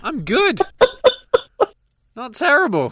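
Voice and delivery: high-pitched comic British voice; Slap Slapley. Acted. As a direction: pitch it way up, like a silly Brit. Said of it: "don't British people say".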